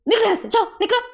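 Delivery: loud, high shout to get out; angry order. Acted. Boss ordering the servant out, loud angry shout. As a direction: throw him out with a shout.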